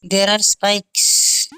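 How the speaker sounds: ordinary talk, nothing marked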